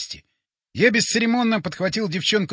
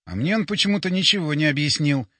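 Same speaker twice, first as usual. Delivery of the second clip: ordinary delivery, nothing marked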